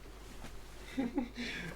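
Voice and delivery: breathy high laugh. Real, not acted. Sudden creature appears.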